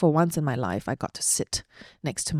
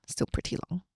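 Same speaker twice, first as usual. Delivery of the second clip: whispered aside; 7 dB quieter than usual. Real, not acted. Wry aside after long story.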